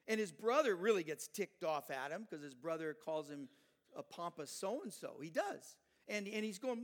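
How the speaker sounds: loud, driving sermon delivery; fiery preaching. Acted. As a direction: preach it hard to the congregation. Preacher at full force on Goliath.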